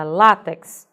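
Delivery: "látex" with a clearly stressed first syllable. Shown as a demonstration. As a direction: hit the LÁ in "látex". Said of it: "vê que ela é bem acentuada". ("látex" bem acentuado na primeira sílaba)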